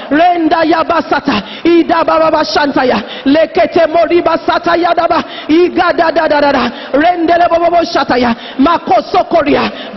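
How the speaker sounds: fervent, rhythmic glossolalia; praying in tongues. Real, not acted. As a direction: pour out unknown syllables in forceful prayer. Prays in tongues.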